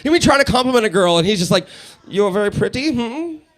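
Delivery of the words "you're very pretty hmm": creepy German with rising high hum; mock German flirt, questioning tail; German accent, questioning. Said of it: "It always sounds like they're asking a question".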